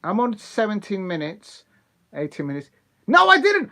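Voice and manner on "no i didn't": much higher, louder protest; loud denial. Real, not acted. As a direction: yelp out the denial. Denying a troll in chat.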